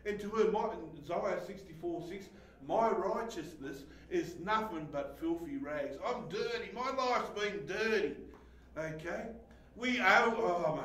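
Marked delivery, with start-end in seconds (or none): none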